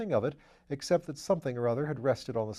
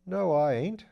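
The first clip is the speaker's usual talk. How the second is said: ordinary delivery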